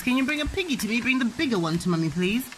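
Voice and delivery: ordinary talk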